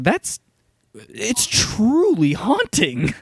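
telling his wife it truly got to him, slowly; haunted, stunned. Retold or imitated. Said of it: "I looked at Abby and I was like".

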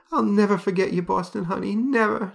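high, trembling mock sob; tearful farewell. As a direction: weep it over the grave, hammed-up. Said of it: "crying as she's throwing roses".

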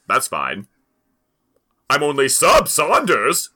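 normal talking voice

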